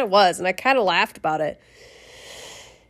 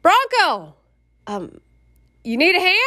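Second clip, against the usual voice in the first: friendly offer; 7 semitones higher than usual. Retold or imitated. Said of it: "he goes".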